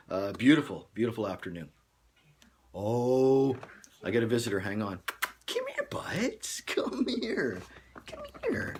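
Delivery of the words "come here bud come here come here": very high, airy pet-calling voice; high-pitched, coaxing pet talk. Real, not acted. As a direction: coax a pet over in a tiny falsetto.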